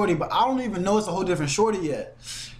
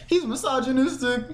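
high, put-on accusing voice; mock accuser; 6 semitones higher than usual. Podcaster imitates people clipping the show, mock accusing. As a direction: imitate an outraged critic in a raised pitch. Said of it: "be like".

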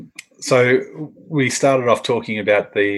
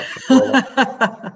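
laughing; 3 dB louder, much slower than usual. Real, not acted. Laughing at own misery.

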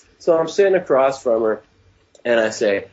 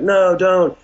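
higher, louder imitation of a pleading wife; wife's urgent plea; 6 semitones higher, 5 dB louder than usual. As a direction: cry out to stop him, retold. Wife tries to stop her husband.

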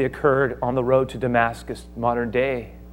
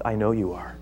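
soft, low and certain; quiet assurance; 4 semitones lower, 6 dB quieter than usual. Acted. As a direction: say it gently to the king. Paul appeals to Agrippa.

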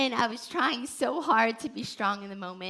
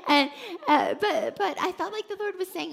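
laughing, higher and louder; 8 semitones higher, 7 dB louder than usual. Real, not acted. Preacher laughing while retelling the car moment. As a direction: chuckle through the words.

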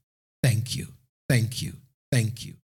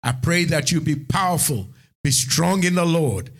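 fervent prayer; 7 semitones higher than usual. Acted.

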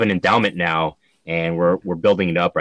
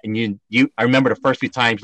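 ordinary talk

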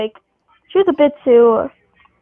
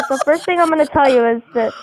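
ordinary talk, nothing marked

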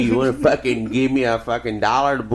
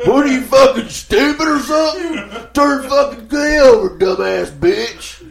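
high, loud furious rant, slow at the end; angry yell; 13 semitones higher, 8 dB louder, slower than usual. Retold or imitated. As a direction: yell it at a dumb clerk. Retold yelling at cashier.

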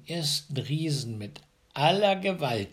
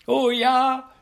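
bright, loud, much higher "Oh ja"; eager reply; 12 semitones higher, 10 dB louder than usual. A narrator's reading. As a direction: jump at the offer. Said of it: "gab er zur Antwort".

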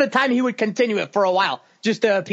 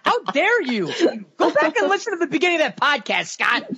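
high-pitched, playful outrage; mock indignation; 5 semitones higher than usual. Scene: telling off the host over her friend's breakup, mock indignant. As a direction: leap in with hammed-up indignation.

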